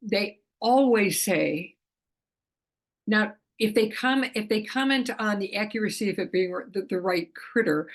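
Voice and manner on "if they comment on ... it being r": ordinary talk, nothing marked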